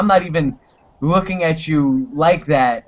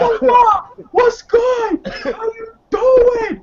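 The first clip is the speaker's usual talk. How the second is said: much higher, loud hammed-up holler; mock catcall; 3 dB louder, much slower than usual. Imitating a catcaller yelling at a woman, loud and high. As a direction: holler it across the street, overdone.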